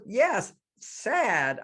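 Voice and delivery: high, whiny mock child; crying little girl. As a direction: imitate a tearful kid agreeing. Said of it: "she started to cry".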